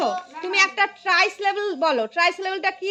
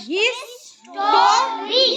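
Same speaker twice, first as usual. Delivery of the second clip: very slow হিস-টো-রি, higher pitched; syllable by syllable; 5 semitones higher, 4 dB louder, much slower than usual. Shown as a demonstration. Teacher leading the class to count syllables, syllable by syllable. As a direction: stretch history into three long beats. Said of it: "হিস্টোরিটা কি বলো তো?".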